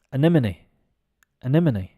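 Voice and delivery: "Anemone" with a weak "uh" first sound; pronunciation demo. Shown as a demonstration. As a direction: model "Anemone" as uh-NEM-uh-nee. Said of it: "the A is actually pronounced like".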